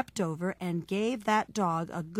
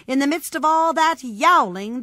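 higher, loud storytelling build; rising clamor; 6 semitones higher, 9 dB louder than usual. A narrator's reading. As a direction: raise pitch and volume over the din. Second step rises over first.

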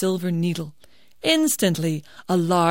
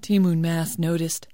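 plain reading voice

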